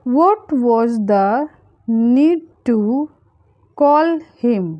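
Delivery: slow, spaced-out words for learners; slow breakdown. Shown as a demonstration. Teacher modelling a translation for learners, slowly and spaced out. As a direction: draw out "What was the need to", pause, then "call him".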